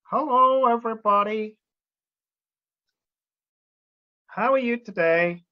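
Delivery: teacher voicing a cowboy puppet to greet the class; Woody puppet voice. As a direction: give the puppet a bright greeting voice. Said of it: "Do you know who this is?".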